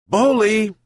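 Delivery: high called name; shout. A narrator's reading. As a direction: call the dog across the yard. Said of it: "shouted the old man".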